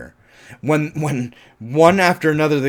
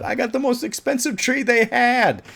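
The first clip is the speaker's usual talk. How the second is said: quoted movie line; 11 semitones higher than usual. Retold or imitated. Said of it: "line that I love from this movie".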